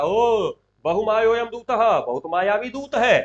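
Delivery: stretched theatrical outcry; Duryodhana crying out. Acted. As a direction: proclaim it to the whole assembly. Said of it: "कह रहा चिल्ला के".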